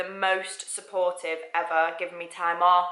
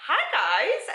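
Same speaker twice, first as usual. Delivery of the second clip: high, drawn-out hello; retold greeting; 10 semitones higher than usual. Speaker greets two fellow radio presenters, high retold greeting.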